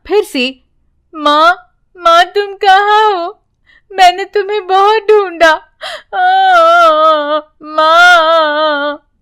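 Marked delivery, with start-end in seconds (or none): 1.1-9.1 put-on high, weepy child's plea; tearful girl searching for her mother; crying again. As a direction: cry out for mother, drawing out the last word. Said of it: "यूं फिर से रोने लगती है".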